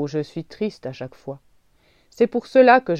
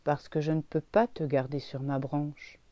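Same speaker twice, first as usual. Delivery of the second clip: sad; 7 semitones lower than usual. A narrator's reading. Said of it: "dit-il tristement".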